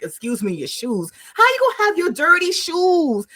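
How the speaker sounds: indignant rant. Retold or imitated. Retelling a guy telling off a girl on the train, building mock scold. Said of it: "He was like".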